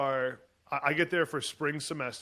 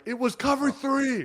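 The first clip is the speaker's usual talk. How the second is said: high, loud, slow shout of defiance; yelling back; 11 semitones higher, 7 dB louder, slower than usual. Retold or imitated. Freshman player yelling back at his coach, loud and defiant. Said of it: "yelled right back at him".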